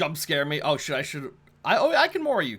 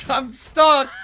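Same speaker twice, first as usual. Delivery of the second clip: laughing shout; 9 semitones higher, 7 dB louder than usual. Real, not acted. Stuck in a game glitch.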